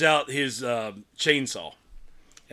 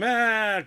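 sharp high yelp of a man falling in; short mock scream; 10 semitones higher, 4 dB louder than usual. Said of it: "you hear him scream".

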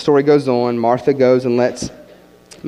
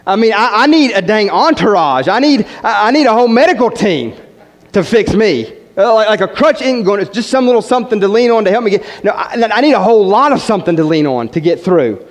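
high, louder, impassioned sermon voice; full-force preaching; 6 semitones higher, 4 dB louder than usual. Acted. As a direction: preach it out, building fervour.

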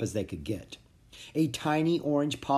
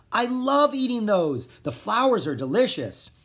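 gushing; 8 semitones higher, 4 dB louder than usual. A narrator's reading. Kid gushing about flowers.

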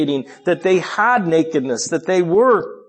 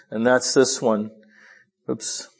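ordinary talk

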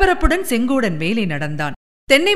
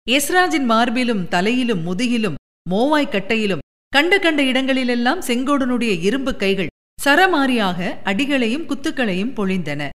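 ordinary delivery

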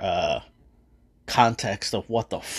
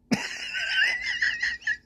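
big laugh; 5 dB quieter than usual. Real, not acted. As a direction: crack up hard at the memory. Laughing at his favorite scene.

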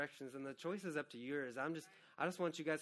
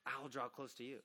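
soft, low, gentle answer; God's tender promise; 3 semitones lower, 7 dB quieter than usual. Shown as a demonstration. Pastor voicing God's promise to the congregation, hushed and tender. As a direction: speak "I'll draw close to you" low and softly, as God. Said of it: "here's his promise".

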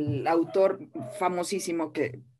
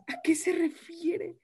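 panicked inner voice; 10 semitones higher than usual. Retold or imitated. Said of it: "Yo decía".

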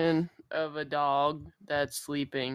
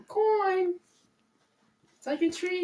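coaxing pet voice; 12 semitones higher than usual. Real, not acted. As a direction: sweet-talk the scared dog. Coaxing dog in sweet voice.

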